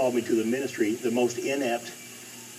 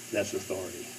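a softer lower repeat of "That's authority"; quiet echo; 4 semitones lower, 8 dB quieter than usual. Acted. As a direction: drop it softer and lower, almost to yourself. Quiet echo of key phrase.

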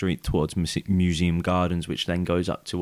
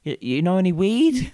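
high, louder stammering mock voice; imitating the girl; 10 semitones higher, 4 dB louder than usual. Mimicking the girl asking two strangers for weed, high and halting. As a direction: squeak it up like the girl pestering. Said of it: "stairs going".

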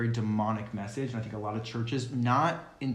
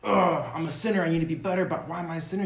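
higher, louder self-pitying whine opening on "Ugh"; whiny mock sinner; 5 semitones higher, 4 dB louder, faster than usual. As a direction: whine it like a guilt-ridden believer. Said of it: "when we keep saying".

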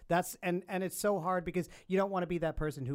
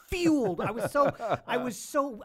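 furious; 6 semitones higher, 4 dB louder than usual. Real, not acted. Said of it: "the furnace of rage inside me".

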